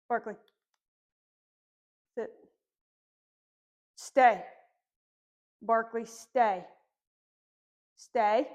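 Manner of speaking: loud, firm "stay" to a puppy; slow, loud firm dog command. Shown as a demonstration. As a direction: hold him in place with your voice. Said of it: "in a pretty firm tone".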